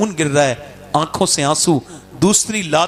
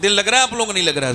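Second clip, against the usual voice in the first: usual speaking voice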